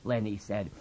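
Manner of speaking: ordinary delivery, nothing marked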